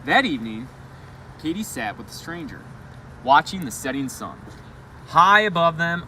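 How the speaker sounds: storyteller voice projecting to a classroom; story read-aloud. Acted. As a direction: read the picture book to the kids with energy. Teacher reads story aloud.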